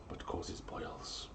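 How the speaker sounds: hushed conspiratorial whisper. Acted. Host whispering the omen's punchline to listeners, whisper. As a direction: drop to a secret whisper for the twist.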